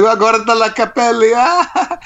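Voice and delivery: high-pitched jeering in Italian, ending in a laugh; mock taunt capped with a high cackle; mocking kid. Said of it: "he was making fun of".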